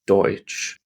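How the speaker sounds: Deutsch with oi and an unvoiced hissed sch; German Deutsch. Shown as a demonstration. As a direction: say Deutsch as doitsh, hissing the end. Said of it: "has that word in it".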